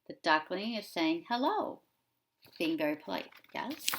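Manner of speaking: ordinary talk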